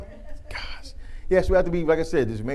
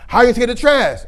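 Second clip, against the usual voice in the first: higher, louder exasperated dad; mock angry dad; 11 semitones higher, 12 dB louder than usual. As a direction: scold the son for leaving the trash. Dad scolds son about trash.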